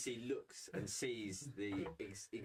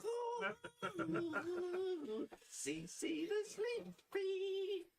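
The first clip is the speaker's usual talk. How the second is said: put-on very high, slow eerie child voice; drawn-out creepy child; much slower than usual. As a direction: stretch it out like a spooky kid.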